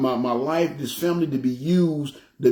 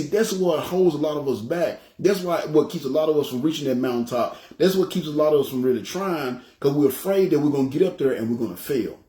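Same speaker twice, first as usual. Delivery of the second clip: ordinary talk, nothing marked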